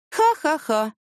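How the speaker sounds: a humourless ha-ha-ha, word by word; emotionless fake laugh. A narrator's reading. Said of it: "ответила Яна без эмоций". (наигранный смех без эмоций)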